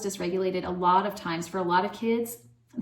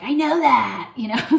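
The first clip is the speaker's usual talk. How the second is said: louder, higher snappy comeback; snippy kid retort; 5 semitones higher, 9 dB louder than usual. Retold or imitated. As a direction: bite back like an irritated child. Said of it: "That's my daughter".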